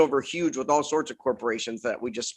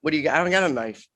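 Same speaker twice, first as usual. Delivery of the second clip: ordinary talk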